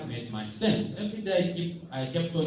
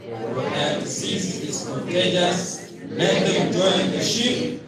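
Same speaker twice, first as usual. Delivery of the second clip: slow, weighty declaration read out to the crowd; slow affirmation recital; 4 dB louder, slower than usual. Shown as a demonstration. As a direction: proclaim each word slowly like a creed. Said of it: "an affirmation I will want us to read".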